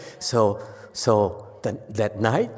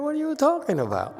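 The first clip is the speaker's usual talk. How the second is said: high, scoffing disbelief; incredulous scoff; faster than usual. Real, not acted. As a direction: scoff at the idea, pitched up high. Teacher scoffs at the story.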